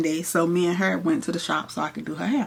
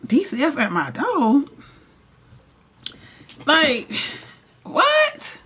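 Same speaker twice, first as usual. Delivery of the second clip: disbelief; 8 semitones higher, slower than usual. Retold or imitated. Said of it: "So I'm like".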